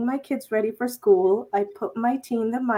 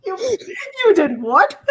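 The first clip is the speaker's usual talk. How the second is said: higher, incredulous through laughter; laughing disbelief; 10 semitones higher, 6 dB louder than usual. Real, not acted. Host reacting to the lighter story, laughing in disbelief. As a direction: laugh out the question, louder as it goes.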